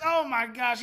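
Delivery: ordinary talk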